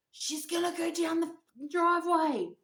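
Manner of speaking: high, loud retold warning; shouting over wind. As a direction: yell back in alarm over a gale. Shouting back over howling wind.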